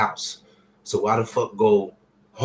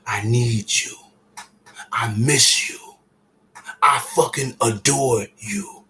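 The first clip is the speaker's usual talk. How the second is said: heartfelt; 5 dB louder than usual. Real, not acted. Urging viewers to say love.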